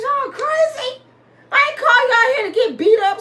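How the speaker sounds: high-pitched, loud disbelief; exasperated squeal of disbelief; incredulous protest. Retold or imitated. Acted disbelieving protest to cousins.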